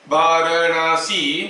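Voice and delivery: Varanasi slowly drawn out, each syllable held apart; slow, even, syllable-by-syllable Varanasi; gaps between syllables. Shown as a demonstration. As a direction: space out the four sounds of Varanasi. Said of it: "zero point one second का gap देना जरूरी है".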